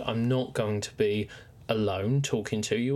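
ordinary talk, nothing marked

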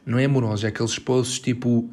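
ordinary delivery

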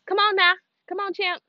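high-pitched call to a dog. Real, not acted. Calling the dog along.

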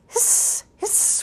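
long, voiceless hiss; cat hissing. A narrator's reading. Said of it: "went the cat".

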